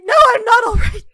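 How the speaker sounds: high, very loud denial; upset, explosive outburst; furious shout. Acted. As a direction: yell back at the concern. Acted outburst to a worried question.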